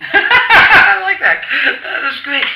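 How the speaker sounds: high, loud burst of laughter into speech; laugh, then talk through the amusement; real laughing. Real, not acted. Amused by the uncle's joke.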